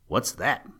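plain reading voice

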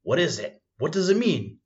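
acted alarm, pitch leaping on the second question; gasped alarm. A narrator's reading. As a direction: demand an answer in rising alarm. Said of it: "I gasped".

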